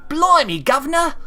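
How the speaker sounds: high, loud, hammed-up Cockney Blimey governor; over-the-top Cockney surprise; mock Cockney exclamation. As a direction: squawk it like a cartoon Londoner. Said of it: "my reaction would be a little more than".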